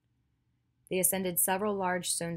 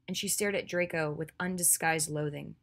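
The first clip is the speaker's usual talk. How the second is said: usual speaking voice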